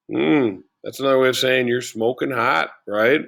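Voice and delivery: normal talking voice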